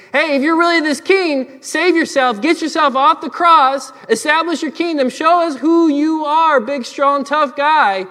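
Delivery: loud, high-pitched sneering dare; mocking yell. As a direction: taunt him like a heckling mob. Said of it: "They were yelling and mocking and saying".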